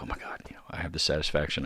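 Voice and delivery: hushed, breathless whisper of awe; awed whisper. Retold or imitated. Imagined gnome hunter whispering in awe. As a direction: whisper as if the gnome might hear.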